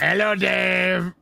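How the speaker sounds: very slow, drawn-out character voice; put-on comic voice with long stretched vowels; Papa Lazarou. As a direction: drag each word out like a creepy sketch character. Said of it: "Papa Lazarou turns up".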